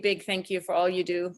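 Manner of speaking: ordinary talk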